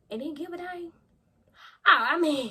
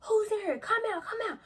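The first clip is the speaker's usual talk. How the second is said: high, loud mock cop call; imitating officers shouting into the house; police calling out; 13 semitones higher, 3 dB louder than usual. Narrator retelling police calling her out of the house.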